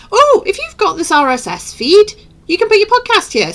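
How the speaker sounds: high, mock helpful quote; high-pitched voice. Host voicing what YouTube told me, high-pitched. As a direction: pitch it right up, imitating. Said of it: "such a high-pitched voice".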